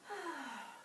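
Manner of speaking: long, soft, falling sigh. A narrator's reading. Bert after his horse faints, sighing long. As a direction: let out a long, weary breath. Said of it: "let out a long sigh".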